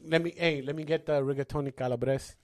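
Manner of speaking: Italian accent. Shown as a demonstration. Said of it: "Now say it again how you said it over there".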